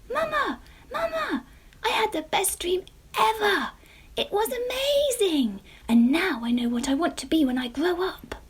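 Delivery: high, loud and breathless with joy; excited child. A narrator's reading. Jemima telling sleepy mamma her dream, high and excited. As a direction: burst out with the news.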